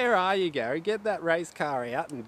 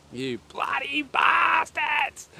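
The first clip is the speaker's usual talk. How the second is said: high, slow, shouted curse; drawn-out mock outrage; 12 semitones higher, 5 dB louder, much slower than usual. Real, not acted. Streamer yelling at chat for spamming, slow high mock howl. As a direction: stretch it out in a loud mock howl.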